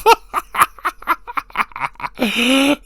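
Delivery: high, helpless giggling; long high-pitched laugh; real laughing fit. Real, not acted. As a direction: keep laughing, running out the fit. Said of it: "laughing so hard".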